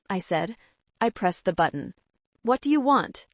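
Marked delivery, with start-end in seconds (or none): 2.4-3.3 higher, louder demand; sharp shout. A narrator's reading. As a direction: snap it at the intercom. Shouting at family via intercom.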